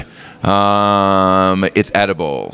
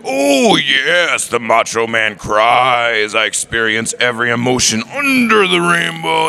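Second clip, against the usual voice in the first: high, raspy wrestler growl, drawn out; Macho Man Randy Savage; 5 semitones higher, slower than usual. Retold or imitated. Fan retelling a favourite clip, as a hammed-up wrestler. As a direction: ham up a wrestling promo, slow and grand. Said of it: "clip of Macho Man Randy Savage talking".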